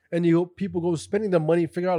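ordinary talk, nothing marked

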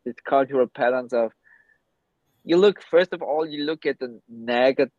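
normal talking voice

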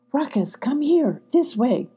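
ordinary delivery, nothing marked

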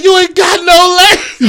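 high, slow, loud mimicry; imitating Forrest Gump. Forrest Gump talking in a meme, high slow mimicry. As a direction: draw it out in a put-on movie voice. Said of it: "he's like".

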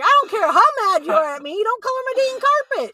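loud, high-pitched mock tirade; scolding that climbs to a shout; retold parental yelling. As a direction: yell it back like an outraged parent.

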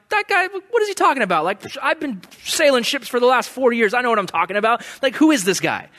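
high, fast, dismissive scoff; scoffing captain. Retold or imitated. Captain scoffing at Paul's warning, dismissive. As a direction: brush off the prisoner.

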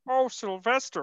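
high frog-puppet voice; retold impression, pitched well up; Kermit the Frog. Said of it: "Kermit the Frog".

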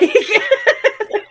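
loud high burst of laughter. Real, not acted. Laughing at Santana's Broadway turn.

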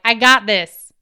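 loud, punchy self-assurance; psyched-up, emphatic burst; confident declaration. Retold or imitated. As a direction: belt it out with bold resolve. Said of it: "and I'm like".

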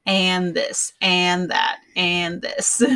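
"and" said with no D, just "an"; "an this, an that" with the D dropped. Shown as a demonstration. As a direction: clip every "and" to "an" without the D. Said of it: "I just say".

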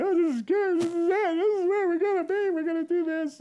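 high, loud hammed-up weeping; mock tearful crying. Couple arriving in Salt Lake City, mock tearful crying. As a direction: wail it through pretend happy tears. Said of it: "We're both in tears crying".

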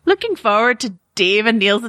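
shaky amateur Scottish brogue; put-on Scottish, slipping as it goes; Scottish accent. Shown as a demonstration. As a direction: read it out in a badly faked Scottish accent. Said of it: "One is in a Scottish accent".

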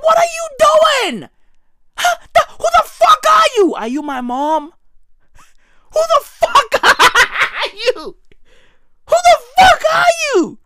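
high shrieking mock confrontation; high shouting disbelief. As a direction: scream it at a stranger in your kitchen. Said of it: "I be like".

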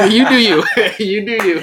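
laughing high and loud. Real, not acted. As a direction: say it through a laugh. Laughing off friend's movie pick.

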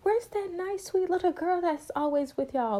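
high, sing-song imitation of a charmed adult; a lightly put-on doting flea-market stranger; sweet fond stranger. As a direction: coo it like a stranger admiring a little girl. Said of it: "And people was like".

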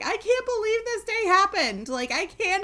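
high, loud retold wailing; drunken disbelief. Retelling her drunken wailing night.

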